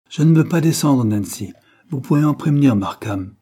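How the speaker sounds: put-on a spoilt boy snapping at the maid; angry refusal. A narrator's reading. Cyril refuses the maid angrily. (Cyril refuse sèchement à la servante, plus fort)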